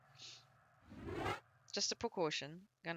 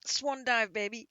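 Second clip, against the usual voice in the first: excited; 6 semitones higher, 6 dB louder, slower than usual. Real, not acted. Jumping off in game.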